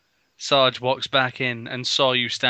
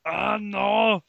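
high, hammed-up wail; anguished Arnold; 9 semitones higher than usual. Arnold finding a pipe in his chest, mock anguish. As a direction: cry out in mock agony.

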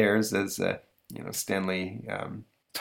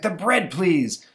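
exasperated mom; slow, high, pointed complaint; fed-up mother; 9 semitones higher, 3 dB louder, much slower than usual. Retold or imitated. Fed-up mother telling dad to pass the bread. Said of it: "she's like".

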